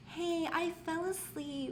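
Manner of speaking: high, slow, drawn-out excuse; mock sheepish. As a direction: float the feeble excuse up high. Acts out her late text to a date.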